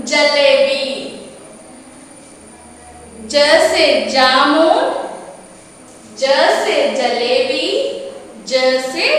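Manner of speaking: normal talking voice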